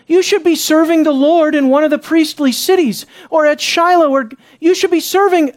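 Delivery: high, pushed, disbelieving questions; incredulous challenge. Acted. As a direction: demand an answer in disbelief. Danites confront the young Levite.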